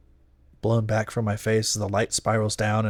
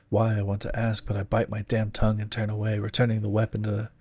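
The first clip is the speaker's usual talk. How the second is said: ordinary delivery, nothing marked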